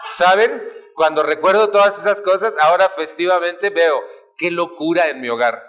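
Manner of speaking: ordinary delivery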